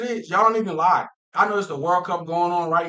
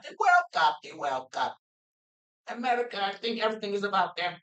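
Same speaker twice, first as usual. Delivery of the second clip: lightly mocking higher-pitched fan voice; mimicking foreign fans; 4 semitones higher than usual. People around the world arguing back, mildly mocking imitation. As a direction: lightly mimic overseas complainers.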